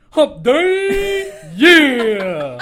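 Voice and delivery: mock shout. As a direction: yell it like a cheesy mascot. Punchline shouted in role.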